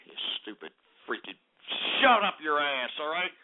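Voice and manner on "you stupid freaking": low unvoiced muttered insult; muttering. Real, not acted. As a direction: mutter it under your breath.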